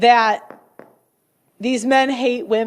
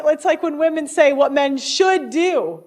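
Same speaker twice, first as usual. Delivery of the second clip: ordinary talk, nothing marked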